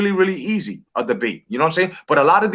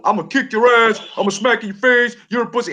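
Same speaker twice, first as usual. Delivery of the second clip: raised pitch, heated trash-talk yell; mock yelling; 5 semitones higher than usual. Debater yelling empty threats at an opponent, mock yelling. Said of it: "while you're yelling".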